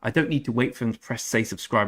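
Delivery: normal talking voice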